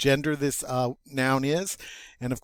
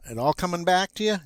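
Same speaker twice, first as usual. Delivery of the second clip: ordinary talk, nothing marked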